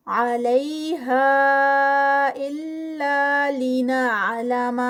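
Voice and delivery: very slow melodic chant with long stretched vowels; Quranic recitation. Shown as a demonstration. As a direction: chant it slowly, holding each long vowel. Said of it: "four counts کا stretch". (لمبے مد کے ساتھ آہستہ تلاوت)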